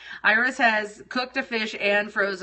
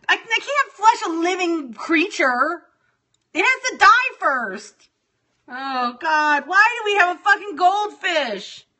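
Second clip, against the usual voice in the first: higher and louder, slow and anguished; really upset; 8 semitones higher, 4 dB louder than usual. Real, not acted. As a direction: wail in real distress over the fish. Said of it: "Now I'm getting really upset".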